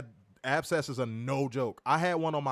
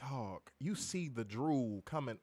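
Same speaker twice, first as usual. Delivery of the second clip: weary mumble; 3 semitones lower, 8 dB quieter, slower than usual. Retold or imitated. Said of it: "I'm like".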